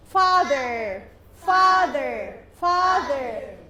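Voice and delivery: "father" loud and drawn out, voiced TH in the middle; hard medial TH. Shown as a demonstration. Teacher drilling TH medially, long emphatic "father". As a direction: model "father" slowly with a buzzing TH. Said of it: "T H medially".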